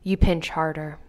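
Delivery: plain reading voice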